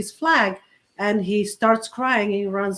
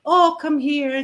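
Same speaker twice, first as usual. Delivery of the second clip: mock coddling; 6 semitones higher, 5 dB louder, slower than usual. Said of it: "be like".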